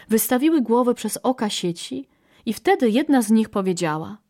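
usual speaking voice

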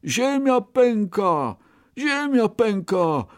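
high, drawn-out cry of alarm; rabbit's cry. A narrator's reading. Scared rabbit calls back to his friend, crying out. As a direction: call it out high and slow. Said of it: "krzyknął".

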